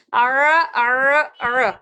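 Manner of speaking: ار with a tight throat ع; throaty ain. Shown as a demonstration. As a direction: push ار from the throat. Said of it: "اس طرح سے".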